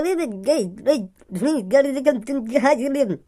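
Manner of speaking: garbled, stuck stammer; speech-impaired babble. Acted. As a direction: choke out broken syllables that form no words. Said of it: "यूँ तो बचपन से ही ऐसे बोले". (अटक-अटक कर अस्पष्ट तुतलाहट)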